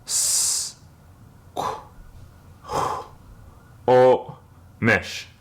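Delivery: "s, qu, o, mish" one syllable at a time; teacher spelling out each syllable; slow syllables. Shown as a demonstration. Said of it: "we'll do this slowly".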